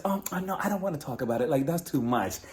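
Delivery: suddenly small, soft voice; hushed, evasive mock demurral; mock reluctance. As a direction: imitate someone shyly backing out. Mocks Brittany suddenly turning shy.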